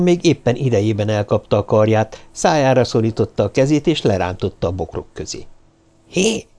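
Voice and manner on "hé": high, startled objection; indignant surprise, pitched up; shocked protest. A narrator's reading. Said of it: "tiltakozott Karahan megdöbbenve".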